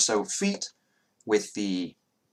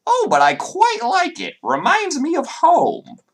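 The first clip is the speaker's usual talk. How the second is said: high masculine voice; 8 dB louder than usual. Acted. Doctor answering his assistant, very high and loud. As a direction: savor it like a creepy ghoul. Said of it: "the masculine voice replies".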